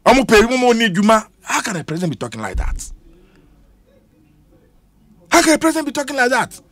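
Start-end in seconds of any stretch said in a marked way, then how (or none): 1.4-2.9 softer, half to himself; quiet disbelief. Real, not acted. Repeated exasperated rhetorical question. 5.3-6.6 higher and fast, incredulous; exasperated outburst. Real, not acted. Repeated exasperated rhetorical question.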